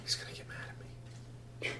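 hushed, near-whispered plea; soft secretive boy; furtive runaway boy. Acted. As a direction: keep it very quiet, barely voiced.

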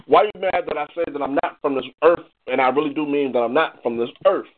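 usual speaking voice

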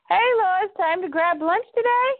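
high, chirpy mock hello; lightly acted office small talk, high-pitched; breezy greeting. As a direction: toss out a friendly invitation, pitched well up. Villain greets Lois while posing as Clark.

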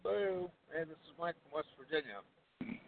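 faint, distant phone line; phone speaker. Real, not acted. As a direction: caller far from the mic. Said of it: "You sound like you're on the other side of the earth".

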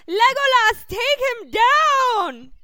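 very high, very loud, slow rallying yell; shouted cheer. Acted. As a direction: scream it across the battlefield. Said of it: "And I go".

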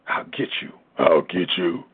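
whispered then very low growling demon; changed demon voice. Shown as a demonstration. Preacher showing the congregation a demon's changed voice, low and menacing. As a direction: let the demon hiss, then drop deep. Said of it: "You know, change the voice".

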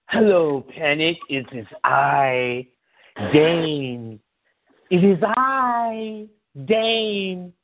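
slow, stately "It is I, Dane"; a mock-regal entrance, stretched slow; grand slow Dane impression. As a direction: announce yourself like royalty. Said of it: "Dane came in".

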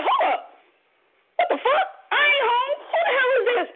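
high-pitched startled questioning. Retold or imitated. Woman waking to find a stranger holding her, startled. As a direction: jolt awake into high, indignant questions.

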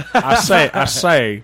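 blustery cartoon rooster, lightly voiced through laughter; Foghorn Leghorn. Host riffing on a cartoon rooster, laughing mock voice. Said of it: "Foghorn Leghorn, that's what it is".

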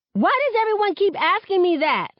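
high, loud exasperated snap; snapping. A narrator's reading. Star snapping at her friends in the locker room, angry. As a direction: bite back at the question. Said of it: "I snap".

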